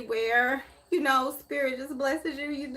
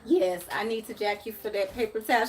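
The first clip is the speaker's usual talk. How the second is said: normal talking voice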